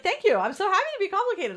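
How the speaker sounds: high, loud, delighted comeback; gleeful thanks. Acted. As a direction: gush it brightly as if flattered by the insult. Acted cheerful reply to an insult.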